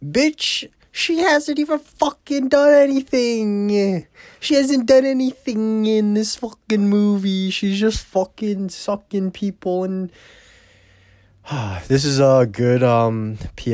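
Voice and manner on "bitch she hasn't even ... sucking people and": higher, exasperated complaint; indignant rant. Real, not acted. Viewer venting about Ginger, higher indignant rant. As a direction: rant at her in disbelief. Ranting at the character.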